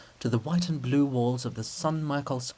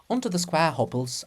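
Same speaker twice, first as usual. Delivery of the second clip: plain reading voice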